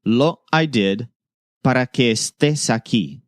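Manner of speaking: slow, careful Spanish phrase; slow model sentence. Shown as a demonstration. Teacher presenting the next basic sentence slowly. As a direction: lay the words out slowly for learners. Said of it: "Our next basic sentence is".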